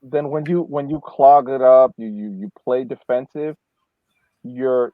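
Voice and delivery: usual speaking voice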